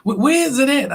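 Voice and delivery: high, quick eager chatter; imitating the lady. Narrator voicing the mall lady asking about barbecue, high and fast. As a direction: voice the excited stranger on the bench. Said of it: "She was like".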